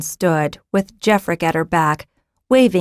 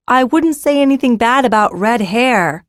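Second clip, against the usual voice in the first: higher, louder warning call; woman warning the girl; calling warningly; 5 semitones higher, 4 dB louder than usual. A narrator's reading. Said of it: "Zinna called warningly".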